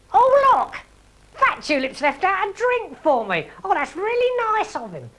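a silly villain sniffing happily; Fred the Baddie's voice. A narrator's reading. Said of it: "he said".

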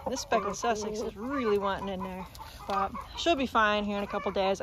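ordinary talk, nothing marked

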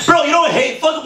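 ordinary talk

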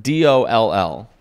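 D O L L spelled out slowly, one letter at a time; spelling the word. Shown as a demonstration. Host spells the word out for his friend to read. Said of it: "How do you say this word".

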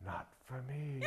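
imitating a little boy's whisper. Little boy in the front row answering the author, whispering. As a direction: whisper it very softly, as a small child would. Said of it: "this little boy ... suddenly whispers".

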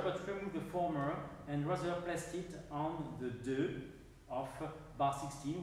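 normal talking voice

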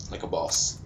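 low, soft aside; understated boast. Real, not acted. Gamer congratulates himself on winning, understated boast. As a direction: drop it quietly under the celebration. Quiet first take before louder repeat.

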